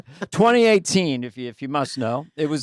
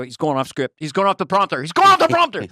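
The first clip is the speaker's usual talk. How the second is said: rising panicky yell, getting louder; panicked staff; 3 semitones higher, 7 dB louder than usual. Retold or imitated. Staff backstage panicking as Biden goes off script, rising yell. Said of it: "they go".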